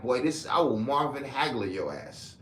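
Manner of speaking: ordinary delivery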